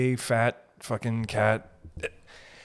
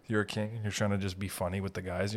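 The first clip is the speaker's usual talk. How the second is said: ordinary talk, nothing marked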